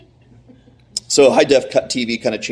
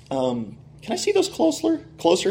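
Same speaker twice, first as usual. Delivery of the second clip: higher, softer tentative voice; tentative request; 11 semitones higher, 4 dB quieter than usual. Retold or imitated. Card dealer asks to inspect the autographs, tentative. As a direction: ask it carefully in a raised, soft voice.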